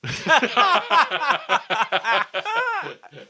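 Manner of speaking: long, high burst of laughter; big laugh. Real, not acted. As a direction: laugh out loud. Laughing at own story.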